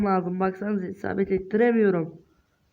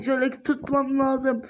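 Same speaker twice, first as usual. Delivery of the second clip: normal talking voice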